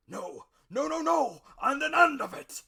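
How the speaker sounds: loud furious captain's roar; crying out. A narrator's reading. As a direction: bellow it like a raging sea captain. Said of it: "he cried".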